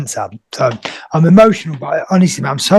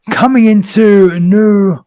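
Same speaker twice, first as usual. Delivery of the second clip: ordinary delivery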